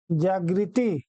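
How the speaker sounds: जागृति low, ending in ti; wrong form. Shown as a demonstration. Teacher shows how many people write it, जागृति with final ti. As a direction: add a final i to जागृति, jaa-gri-ti. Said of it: "ये भी शुद्ध नहीं".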